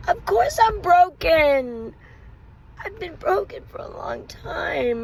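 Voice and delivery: high, slow, strained cry of hurt; pained outburst. Real, not acted. Answering husband's claim she is broken, high pained wail. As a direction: let the hurt crack the voice up high. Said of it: "admits being broken".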